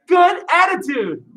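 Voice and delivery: shouted call. Shown as a demonstration. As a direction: shout it out for the students. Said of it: "Everybody say".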